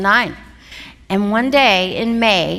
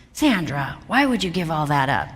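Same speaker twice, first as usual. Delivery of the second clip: lower, quicker imitation of a dad; disapproving dad; 5 semitones lower, 4 dB quieter than usual. Dad questioning his daughter about quitting law, disapproving. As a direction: gently reprove in a lowered voice. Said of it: "I could just hear his voice".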